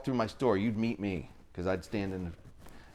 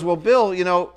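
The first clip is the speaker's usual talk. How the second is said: wife voice; 9 semitones higher, 12 dB louder than usual. Retold or imitated. Wife voiced in a heated argument.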